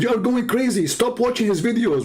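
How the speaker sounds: high-pitched imitation of a scolding wife; animated, high mock exasperation; exasperated wife. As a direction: scold your video-obsessed husband. Acted wife scolding husband.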